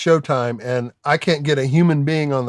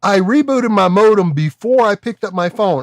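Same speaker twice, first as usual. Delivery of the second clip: exasperated retold rant; 5 semitones higher than usual. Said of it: "I'm like".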